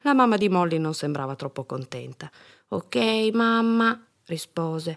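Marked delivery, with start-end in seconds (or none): 2.7-4.0 put-on higher, drawn-out child voice; reluctant child obeying; resigned little girl. A narrator's reading. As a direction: give in to mum like a small kid. Girl gives in to mother.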